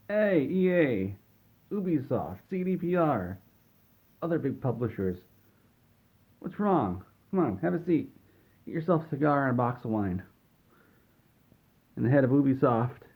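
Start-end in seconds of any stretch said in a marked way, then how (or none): 0.1-10.2 hammed-up welcome. Phil inviting publishers to have a seat, breezy mock welcome. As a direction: greet rivals like old pals, voice lifted.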